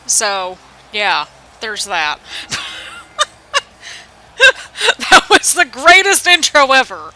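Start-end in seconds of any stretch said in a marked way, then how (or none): 2.2-3.2 high-pitched burst of laughter. Real, not acted. Laughs at the intro. 5.1-7.1 loud, high, amused praise; excited. Real, not acted. Laughing over the intro.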